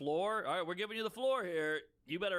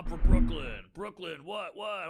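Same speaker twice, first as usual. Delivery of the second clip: loud, brash Brooklyn swagger; mock Brooklyn tough; 10 dB louder, slower than usual. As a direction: brag about Brooklyn like a loudmouth. Mocking Brooklyn bragger.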